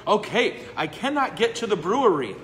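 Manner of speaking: hammed-up high, loud wail on cannot; mock lament. Priest bemoaning the far brewery, mock wail. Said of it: "If I get emotional".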